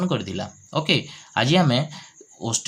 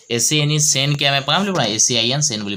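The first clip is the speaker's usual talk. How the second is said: ordinary talk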